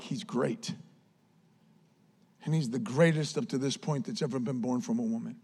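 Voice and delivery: low, soft, understated declaration; hushed quote. Retold or imitated. Preacher quoting Jesus on John, low and hushed. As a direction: drop right down to quote it reverently.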